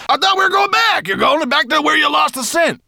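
very high, loud protest; cross shouting. A narrator's reading. Dwarf objecting to dragon changing course, crossly shouting. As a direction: shout your complaint indignantly. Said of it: "he called crossly".

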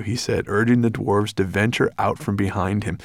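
usual speaking voice

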